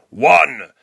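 booming, slow prophet voice; biblical tone. Pretend. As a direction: proclaim each commandment like scripture. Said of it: "I'll keep the biblical tone for dramatic effect".

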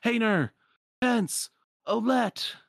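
loud, high-pitched yelling; full-voiced cry for missing friends; desperate yell. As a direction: yell each name out separately. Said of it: "And then he yells it".

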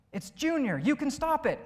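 high, urgent cartoon plea; acted urging. Pastor voicing the cartoon figure urging Junior, high and urgent.

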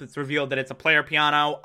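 ordinary talk, nothing marked

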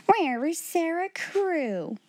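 high, slow, stern demand; almost fierce. A narrator's reading. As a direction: demand it like an angry headmistress. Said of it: "voice was almost fierce when she answered".

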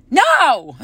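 short, sharp mock shout of a toddler; imitating toddler's yell. Retelling the three-year-old refusing her, mock yell. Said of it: "And she yells".

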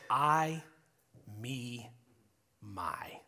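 "I," "me," "my." each set apart by pauses, falling and fading; stressed list. Shown as a demonstration. Pastor counting Jonah's selfish words, pausing after each. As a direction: lay out "I," "me," "my." slowly, one by one. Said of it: "Nine times in the Hebrew".

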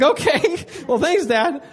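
a startled, delighted thank-you; surprised thanks. Retold or imitated. Surprised by dad's answer.

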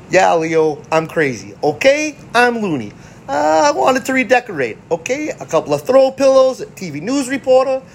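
high, mocking sarcastic rant; sarcastic Raph. Raph sarcastically answering Leo about bringing April, high mocking voice. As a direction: play it as dripping sarcasm. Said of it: "it's Raph's sarcasticness".

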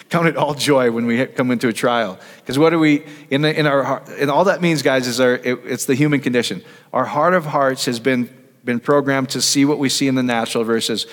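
ordinary talk, nothing marked

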